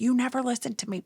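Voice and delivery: fast, high, exasperated outburst; raging mom. Retold or imitated. Mom losing patience with her daughter, raging outburst. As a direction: snap it out quickly and high-pitched. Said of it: "And I'm raging".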